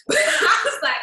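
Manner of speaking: high squealing laugh into the line; laughing at herself, voice jumping high. Real, not acted. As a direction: burst out laughing, pitch shooting up. Laughs recalling her claim.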